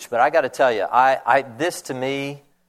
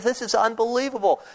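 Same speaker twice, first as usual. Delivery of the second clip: much higher, wide-eyed delight; amazed leper; 9 semitones higher than usual. Retold or imitated. Starving leper marveling at the food, much higher and amazed. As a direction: marvel at the feast. Lepers stuffing mouths in camp.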